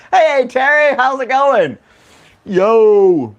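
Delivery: high-pitched, hollered hello; excited hailing. Real, not acted. Host greeting a latecomer to the show, excited hailing. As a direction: call out to a friend who just arrived.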